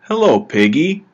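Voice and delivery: a hint of a man cooing at his phone pet; brother greeting the pig. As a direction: greet the pig app a bit brightly, barely acted. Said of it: "This brother will say".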